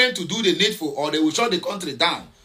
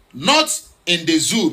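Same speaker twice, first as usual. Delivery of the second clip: ordinary delivery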